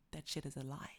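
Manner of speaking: low sultry whisper; voice dropped low. Shown as a demonstration. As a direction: drop the voice to a whisper. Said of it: "Do you see how my voice dropped there?".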